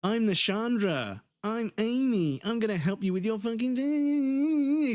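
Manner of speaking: high, slow gushing greeting; gushing server. Retold or imitated. Mocking server introducing herself to a customer. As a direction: stretch it out sweetly.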